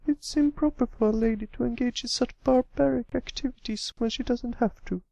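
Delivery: high, prim, ladylike put-on voice; a filly mimicking her fussy elegant sister; Rarity impression. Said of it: "put on her best Rarity impression".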